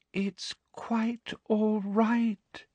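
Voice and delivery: very slow, high and soft, sickly sweet; sugary Umbridge. A narrator's reading. Umbridge soothing Neville with a fake smile. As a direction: purr it with false kindness.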